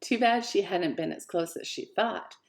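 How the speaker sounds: plain reading voice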